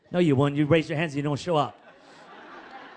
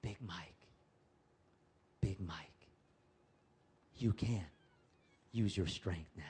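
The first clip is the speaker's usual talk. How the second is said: low, much softer, a light hint of someone coaxing; lightly voiced coaxing whisper, barely there; 10 semitones lower, 10 dB quieter than usual. Someone quietly urging Big Mike to use his strength, mild whisper.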